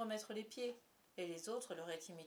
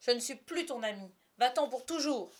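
loud, higher, furious dismissal; angry shout; 4 semitones higher, 9 dB louder than usual. A narrator's reading. Peter banishes the fairy forever, angry shouting. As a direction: banish her at full voice. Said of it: "cria-t-il".

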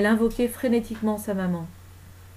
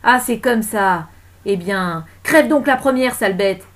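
loud menace building to an outburst; loud, vengeful threat; furious curse; 3 semitones higher, 9 dB louder, slower than usual. Acted. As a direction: curse her with venom. Enraged woman curses the lady.

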